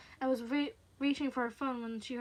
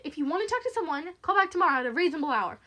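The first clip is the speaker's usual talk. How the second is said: loud, raised, defiant dismissal; indignant and forceful; angry retort; 6 semitones higher, 12 dB louder, faster than usual. Acted. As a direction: tell the caller off. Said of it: "anger replacing her nervousness".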